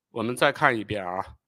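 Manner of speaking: ordinary delivery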